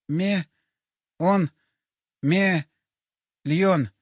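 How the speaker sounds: broken syllables with long pauses; dying man forcing out fragments; struggling to speak. A narrator's reading. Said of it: "Он что-то попытался проговорить".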